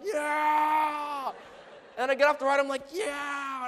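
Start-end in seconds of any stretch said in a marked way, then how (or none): 0.1-1.3 loud, high, drawn-out whoop; acted thrill-ride yell; exhilarated cheer. Cheers once the ride launches. 2.9-3.7 triumphant cheer. Retold or imitated. Cheers getting off the ride.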